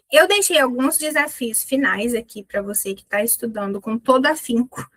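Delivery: ordinary talk, nothing marked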